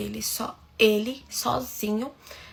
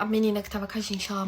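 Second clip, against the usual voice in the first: usual speaking voice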